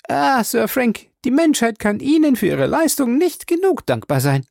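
high, effusive lady's voice; gushing Mrs Baker. A narrator's reading. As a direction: flatter him breathlessly.

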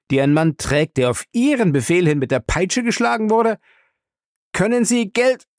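rising indignant outrage; angry. A narrator's reading. Archer accusing Bellamy of tormenting the innocent, angry and rising. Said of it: "sagte der andere zornig".

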